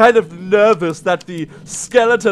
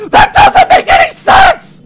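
very high, loud protest; exasperated shout; 6 dB louder than usual. Real, not acted. Player rants about Fritz's British accent, exasperated shout. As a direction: yell it in disbelief.

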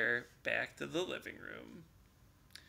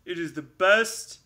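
proud announcement; 7 dB louder than usual. Acted. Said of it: "Bear announced proudly".

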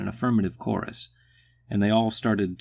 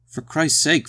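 higher, louder burst of impatience; exasperated; 7 semitones higher, 5 dB louder than usual. A narrator's reading. Carl to Phil who will not get up, exasperated. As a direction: protest in exasperation.

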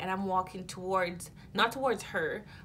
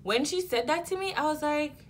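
worked up; 8 semitones higher, 5 dB louder than usual. Real, not acted. Agitated retelling of the moment.

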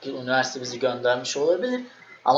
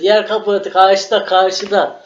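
yüksek perdeden, yüksek sesle ve ağır; bağırma taklidi; 5 semitones higher, 7 dB louder, slower than usual. Retold or imitated. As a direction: arka sıradan sınıfa bağırır gibi. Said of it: "bas bas bağırıyor". (Uşaklı arkadaş arkadan bağırarak uyarıyor)

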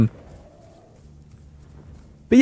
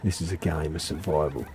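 low, much softer murmur; hushed survival host; 9 semitones lower, 10 dB quieter than usual. Pretend. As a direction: play a hushed, mock-serious survival narrator. Mock survival-show host aside.

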